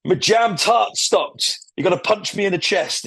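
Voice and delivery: lightly voiced gruff detective; Rabbit quote. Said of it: "sums it up by saying".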